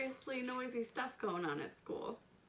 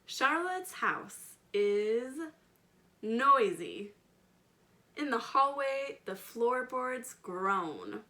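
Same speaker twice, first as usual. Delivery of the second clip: slow, louder storybook reading with big emphasis; reading to children; 4 semitones higher, 6 dB louder, slower than usual. A narrator's reading. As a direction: paint each noise for the little listeners. Expressive read-aloud narration.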